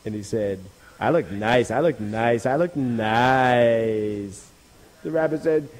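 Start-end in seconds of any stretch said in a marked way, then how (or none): 1.0-4.5 drawn-out self-admiring croon. Acted. Dog admiring his reflection in the water, self-admiring. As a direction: savour each repeat, stretching the last.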